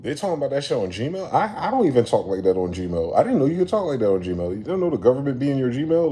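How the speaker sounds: loud, high-jumping disbelief; incredulous. Real, not acted. Comic reacting to reckless talk on Gmail, incredulous. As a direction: protest it loudly, voice leaping up in disbelief.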